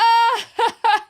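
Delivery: high, loud burst of laughter. Real, not acted. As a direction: crack up out loud.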